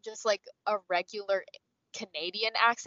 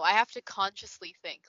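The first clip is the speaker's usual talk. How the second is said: ordinary delivery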